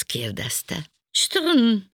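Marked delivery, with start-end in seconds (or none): 1.1-1.8 high, loud vocal rattle; imitated clink. A narrator's reading. Rainpipe clinking at Panka, imitated jangle. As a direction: voice a short metallic jangle, falling. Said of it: "csörrent megint valami".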